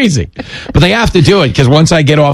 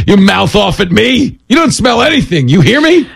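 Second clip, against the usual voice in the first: mock scolding; 7 semitones higher than usual. Scolding the sniffer dog.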